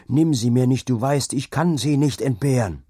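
loud, anguished plea; crying out. A narrator's reading. Husband begging God to spare his wife, crying out. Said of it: "schrie er".

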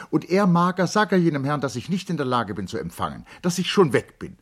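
high, loud, flustered commands; rushing to end the visit; hurried lady. Acted. Charlotte hurried, ordering Mager. (gehetzte Dame, hoch und laut)

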